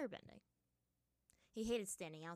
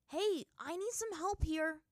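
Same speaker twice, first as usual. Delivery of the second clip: loud, high, drawn-out call; impatient; 9 semitones higher, 10 dB louder, slower than usual. A narrator's reading. Aang calls to the humming monkey, impatient. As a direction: demand attention with raised voice. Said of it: "not feeling particularly patient today".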